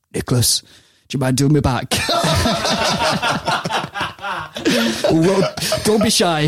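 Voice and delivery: put-on high, hammy northern accent; bad Steve Bruce impression, laughing. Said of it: "It's a terrible Steve Bruce impression".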